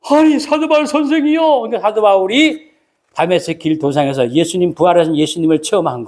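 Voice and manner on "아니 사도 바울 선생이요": high, startled cry of recognition; startled greeting. Retold or imitated. Ananias startled to meet Paul, high surprised call. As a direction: gasp out his name in shock. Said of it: "아니가 놀래가지고".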